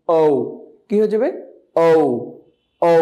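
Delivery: usual speaking voice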